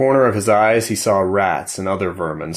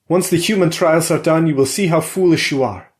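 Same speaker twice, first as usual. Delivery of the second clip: louder, higher yell at his wife; 9 semitones higher, 5 dB louder than usual. A narrator's reading. Husband yells at his wife as she storms off. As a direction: shout after her as she leaves. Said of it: "he yelled".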